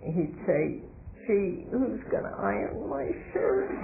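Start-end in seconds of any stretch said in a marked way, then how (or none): 1.3-3.7 higher-pitched mimicked grumble; mock husband complaint. Wife retelling husband's worry over his ironing, mock complaint. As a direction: imitate a put-out husband, pitched up.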